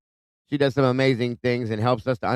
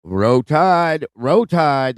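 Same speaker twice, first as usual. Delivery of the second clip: louder, higher Alabama cheer; excited cheer; 5 semitones higher, 7 dB louder, much slower than usual. Real, not acted. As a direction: cheer Row Tide like a fan. Cheering Alabama fans in chat.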